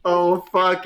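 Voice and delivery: usual speaking voice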